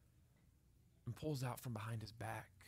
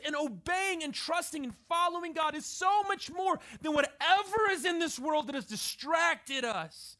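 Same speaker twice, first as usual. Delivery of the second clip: much higher and louder, driving sermon climax; full-force preaching; 13 semitones higher, 9 dB louder than usual. Acted. Pastor urging the congregation to lay their pearls on the altar. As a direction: preach it out, building with urgency.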